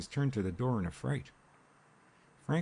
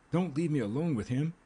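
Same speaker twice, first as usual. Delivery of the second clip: high-pitched frightened begging; panicky, voice pitched well up; breathed plea; 7 semitones higher, 5 dB louder than usual. A narrator's reading. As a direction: plead urgently not to be abandoned. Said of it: "she breathed".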